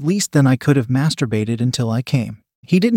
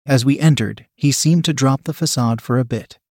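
ordinary delivery, nothing marked

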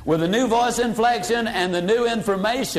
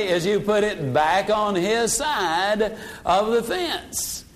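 usual speaking voice

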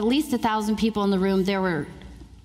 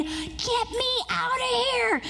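high, slow, small frantic voice; stage fright whimper; panicked plea; 14 semitones higher, slower than usual. Retold or imitated. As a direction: beg to escape, voice thin and lifted. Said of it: "I was saying".

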